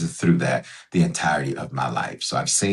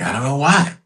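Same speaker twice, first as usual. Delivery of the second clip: higher-pitched mock bewilderment; puzzled self-talk; 8 semitones higher than usual. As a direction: act out puzzling over yourself, pitched up. Voicing people puzzled at themselves.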